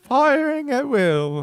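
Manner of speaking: put-on high, loud falsetto old lady. Co-host joins the old-lady bit, high and loud. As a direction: chime in as a gossiping old woman.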